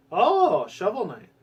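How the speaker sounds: light up on the name; oh, it's the one I wanted; excited recognition. Real, not acted.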